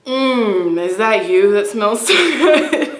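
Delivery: loud, drawn-out imitation of a come-on, cracking up; can't get the pick-up line out without laughing; breaking into laughter. Woman imitating a stranger's coffee-shop come-on, breaking into laughter. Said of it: "I can't say that without laughing".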